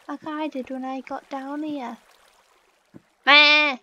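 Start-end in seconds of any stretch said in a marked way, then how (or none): 3.3-3.8 loud bleating goat meh. Acted. Gamer imitating a goat, loud bleat. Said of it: "I'm a goat".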